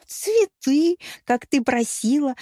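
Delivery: high, puzzled protest; bewildered. A narrator's reading. Hapless suitor explaining his gift, high bewilderment. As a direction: answer in baffled innocence, voice jumping up. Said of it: "недоумённо пробормотал горе-поклонник".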